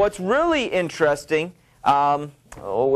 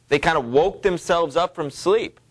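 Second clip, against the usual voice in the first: normal talking voice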